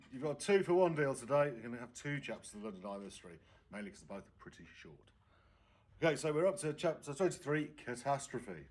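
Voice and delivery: plain reading voice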